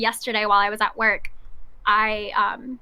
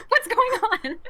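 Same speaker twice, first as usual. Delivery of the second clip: high-pitched, through real laughter; laughing; 11 semitones higher than usual. Real, not acted. Storyteller joking with friends about losing job, laughing. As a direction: squeal the question while cracking up.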